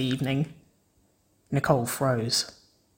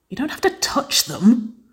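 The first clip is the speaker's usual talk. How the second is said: scoffing; 9 semitones higher than usual. A narrator's reading. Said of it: "Wynonna scoffed".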